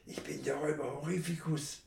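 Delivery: soft lisping robber voice. A narrator's reading. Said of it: "lispelte er".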